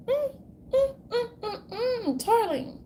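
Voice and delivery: high, whimpering mock sob on mm; hammed-up fake crying hum; a put-on weepy moan, high and breaking.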